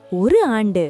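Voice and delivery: plain reading voice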